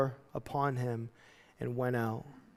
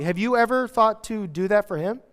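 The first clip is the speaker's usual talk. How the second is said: ordinary talk, nothing marked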